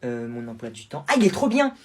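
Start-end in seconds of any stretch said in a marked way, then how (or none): 1.1-1.7 high, loud burst of delight; sudden excited exclamation; gushing. Acted. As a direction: squeal with enthusiasm. Girl enthuses about her timetable.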